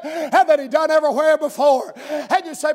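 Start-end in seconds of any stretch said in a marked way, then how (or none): none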